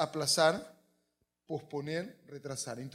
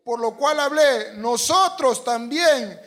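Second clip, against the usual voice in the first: high, slow, forceful declaration; drawn-out full-voice scripture proclamation; strong, loud reading; 7 semitones higher, 12 dB louder, slower than usual. Real, not acted. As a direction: lead everyone shouting the verse.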